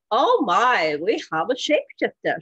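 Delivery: a hammed-up British lady's accent, delighted; poor British accent. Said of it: "sorry for the poor British accent".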